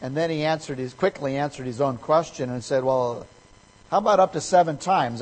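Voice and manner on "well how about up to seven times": higher, louder, pleased with himself; eager Peter. Retold or imitated. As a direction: pitch it as a proud offer. Retold Peter offering a generous number.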